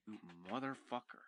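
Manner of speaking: muttered curse. Real, not acted. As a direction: grumble at the dog. Cursing at chewing dog.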